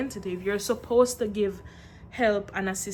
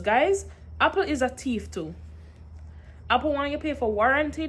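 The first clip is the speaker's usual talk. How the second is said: ordinary talk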